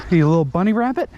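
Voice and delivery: quick playful cooing; fast pet talk. Real, not acted. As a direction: rattle it off to the goat. Cooing to a goat.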